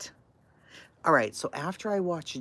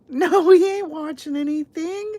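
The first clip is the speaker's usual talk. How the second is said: high, loud flat no; loud refusal; 11 semitones higher, 10 dB louder than usual. Real, not acted. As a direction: shout it down, refusing. Refuses to watch.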